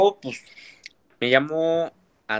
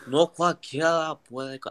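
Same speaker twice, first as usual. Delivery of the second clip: put-on French accent; 3 semitones higher, slower than usual. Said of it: "déjame ver si me sale".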